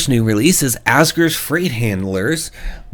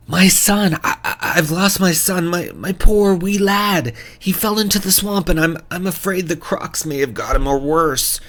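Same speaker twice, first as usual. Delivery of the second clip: much higher, pleading fisherman with a Scottish-style lilt; desperate Scots-flavoured fisherman begging for help; distraught fisherman accent; 10 semitones higher than usual. Acted. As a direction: play the panicked father in a Scots-tinged accent. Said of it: "I didn't do the best accent there".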